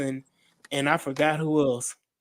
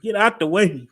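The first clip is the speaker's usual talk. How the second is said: louder, higher punt returner's call; playful holler to clear off; mock warning call; 4 semitones higher, 6 dB louder than usual.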